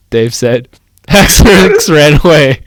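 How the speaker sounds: ordinary talk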